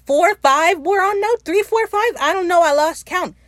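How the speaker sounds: high, loud, flustered counting; excited exasperation. Real, not acted. Fan losing count of theme song plays, excitedly exasperated. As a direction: blurt it out high and loud, giving up.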